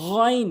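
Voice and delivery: "গইন" with throaty gargled gh; correct pronunciation. Shown as a demonstration. Teacher models the Arabic letter, gargled "গইন". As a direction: rasp the first sound of "গইন" from the throat. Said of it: "সঠিক উচ্চারণ হচ্ছে".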